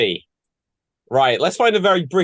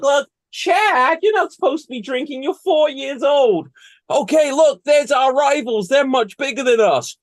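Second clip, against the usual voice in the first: put-on high-pitched, earnest child voices; pleading kid players; 11 semitones higher than usual. Kids talk their coach into teaching them, high and insistent. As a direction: argue like little kids, pitched well up.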